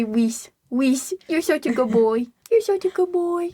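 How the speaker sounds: put-on baby-talk to a good dog; pet talk. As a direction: praise a pet, climbing into a high coo. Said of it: "I say".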